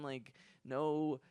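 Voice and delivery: ordinary talk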